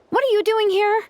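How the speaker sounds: high-pitched, flustered surprise; startled question. Acted. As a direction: blurt it out, caught red-handed. Caught off guard by visitors.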